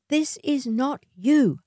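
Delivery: cautioning. A narrator's reading. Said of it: "she cautioned".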